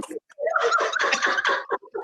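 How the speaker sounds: high squealing laugh. Real, not acted. Laughing at own story.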